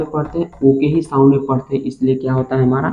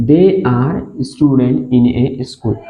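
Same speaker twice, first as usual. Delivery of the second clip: they are student very slowly; slow dictation; much slower than usual. Shown as a demonstration. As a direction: space every word out.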